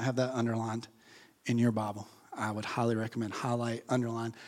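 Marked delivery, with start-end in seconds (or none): none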